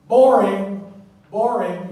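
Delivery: very loud, drawn "boring"; "boring" belted out, heavily stressed; loud mock boredom. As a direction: bellow your boredom at the room. Mocking dull slides.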